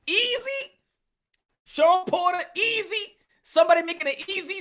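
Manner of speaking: "Easy" sung out high and faked; mock the nickname "Easy" up high. Shown as a demonstration. Mocking the nickname "Easy" to chat, in a high put-on voice. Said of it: "mocking repeat of Easy".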